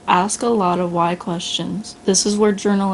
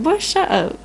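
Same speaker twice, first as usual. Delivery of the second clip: put-on high, sharp "Boy" brushing him off; dismissive retort; 8 semitones higher than usual. Improver dismissing the complainer, exasperated. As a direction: shut him down with a high snap. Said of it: "It's like".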